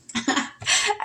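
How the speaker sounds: a sudden high squeal of a laugh; high choking laugh. Real, not acted. Reader cracking up mid-reading, high choked laugh. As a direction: laugh till you almost choke. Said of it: "I almost choked".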